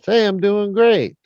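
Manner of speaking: a hint of a high horse voice; thrown horse voice. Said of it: "he throws his voice".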